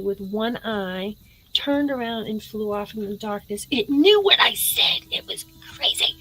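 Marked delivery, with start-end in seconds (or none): 3.7-6.2 hushed, voiceless storytelling aside; awed whisper. Real, not acted. Storyteller telling viewers of the owl's wink, awed whisper. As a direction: whisper it like an uncanny secret.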